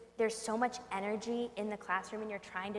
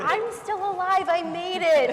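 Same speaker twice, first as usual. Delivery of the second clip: high, loud, drawn-out exclamation; mock triumph; 10 semitones higher, 13 dB louder, slower than usual. Teacher surviving classroom chaos, mock triumphant. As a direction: crow it like a hammed-up survivor.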